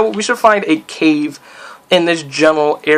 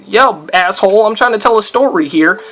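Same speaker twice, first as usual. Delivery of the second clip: startled yelling; 5 semitones higher than usual. Real, not acted. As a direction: yelp and complain as the mobs attack. Attacked mid-story in the game.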